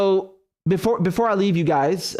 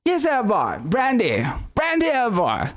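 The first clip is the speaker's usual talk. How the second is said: hammed-up old-film British gent, higher; playful Queen's English impression of a gentleman; posh British accent; 6 semitones higher, slower than usual. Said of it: "but it's a funny accent".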